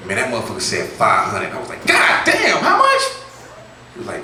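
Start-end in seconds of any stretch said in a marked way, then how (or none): 1.9-3.0 very high, loud shocked yell; very high shocked shout. Retold or imitated. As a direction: blurt it out in disbelief. Shocked at price.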